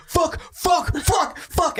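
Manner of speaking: high, strained panicked curses; panicking fish. Pretend. Fish hanging from the hook, high panicked cursing. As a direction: gasp them out while dangling from the hook. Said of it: "hanging by its mouth like".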